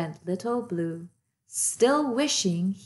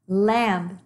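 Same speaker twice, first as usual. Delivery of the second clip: ordinary delivery, nothing marked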